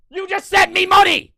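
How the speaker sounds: high, exasperated scream; furious shout. Real, not acted. Ranting at viewers who file chargebacks, screaming. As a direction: yell it at the top of your lungs. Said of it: "Yeah, I'm screaming".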